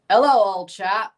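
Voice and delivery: attempted British accent. Shown as a demonstration. Streamer showing chat a Brit greeting, attempted British accent. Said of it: "that didn't really work".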